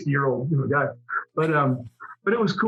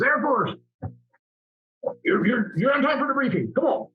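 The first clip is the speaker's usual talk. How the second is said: clipped, higher southern Army commander voice; southern accent; 7 semitones higher than usual. Retold or imitated. Battalion commander hurrying the Air Force pilot, southern accent. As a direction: play a Southern battalion commander calling him in. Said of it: "he had this southern accent".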